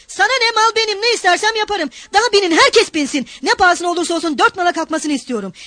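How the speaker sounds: high-pitched, belligerent retort; defiant outburst. A narrator's reading. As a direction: throw it back at the hecklers. Mikolka retorts to the protesting crowd.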